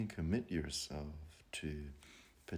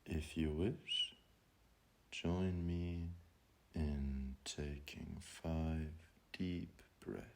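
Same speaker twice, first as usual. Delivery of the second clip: guided meditation; 8 dB quieter than usual. Acted. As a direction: ease them gently into calm, drawing the words out. Leads a breathing meditation.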